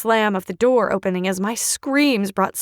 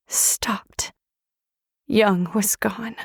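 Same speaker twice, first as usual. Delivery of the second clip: hushed grief; much slower than usual. A narrator's reading. Grief after death.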